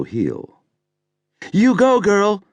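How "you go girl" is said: high, loud cheer from the crowd; high cheering call. A narrator's reading. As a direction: whoop it out like a fan at a drag show. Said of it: "someone called".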